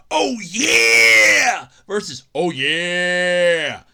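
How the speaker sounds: loud mock wrestler roar; hammed-up Oh yeah shout; wrestler catchphrase. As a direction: bellow it like a wrestling promo. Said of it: "It's gonna be".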